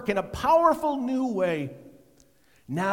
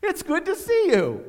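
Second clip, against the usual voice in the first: loud, high happy recognition; louder, higher delighted hello; surprised greeting; 10 semitones higher, 9 dB louder than usual. Retold or imitated. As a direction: call out on spotting an old friend. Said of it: "it was like".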